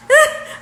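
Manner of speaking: sudden high, loud burst of laughter; loud high cackle; real laugh. Real, not acted. Laughs at her own demand.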